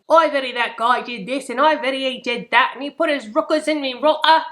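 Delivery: badly faked Cockney storyteller; mock Cockney. Shown as a demonstration. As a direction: put on a rough-and-ready amateur Cockney accent. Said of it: "almost like English Cockney".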